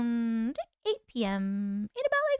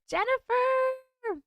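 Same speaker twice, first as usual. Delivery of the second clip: calling out; 13 semitones higher, much slower than usual. Real, not acted. Greeting a viewer, drawn out.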